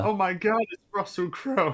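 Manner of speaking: high, gushing fan squeal; mock starstruck gasp. Mocking a fan spotting Russell Crowe, high gushing squeal.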